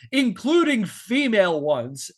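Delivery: slow and pointed, with weight on female; slow pointed quote. Shown as a demonstration. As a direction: lean into the quote with mock emphasis.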